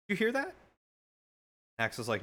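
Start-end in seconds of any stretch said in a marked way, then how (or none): 0.1-0.5 alert question. Retold or imitated. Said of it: "I'm like".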